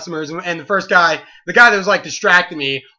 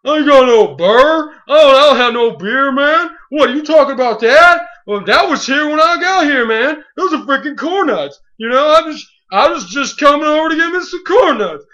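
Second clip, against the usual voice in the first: higher, louder put-on voice of a defensive teen; mock innocent kid; 4 semitones higher, 5 dB louder than usual. Teen caught shoplifting, denying it to the clerk, hammed-up whine. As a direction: play dumb, protest way too hard. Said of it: "he tries to play like".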